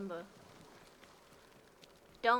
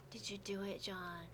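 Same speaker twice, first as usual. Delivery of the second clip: soft, low, husky sexy voice; breathy seductive murmur; 4 semitones lower, 16 dB quieter than usual. Acted. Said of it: "I'm trying to do my sexy voice for John".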